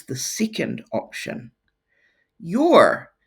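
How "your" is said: loud word. Shown as a demonstration. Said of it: "it's a contraction".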